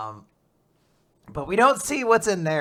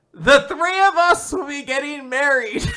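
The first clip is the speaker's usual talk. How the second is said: high, loud mock proclamation; Rip announcing; 9 semitones higher, 8 dB louder than usual. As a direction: proclaim it to the whole crowd, hammed-up. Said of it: "Rip announces to everyone".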